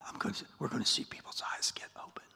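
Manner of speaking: fast, breathy whispered promise. Acted. Preacher voicing Paul, whispering his mission. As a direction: whisper it like a secret. Paul's vision told intimately.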